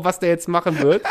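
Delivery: normal talking voice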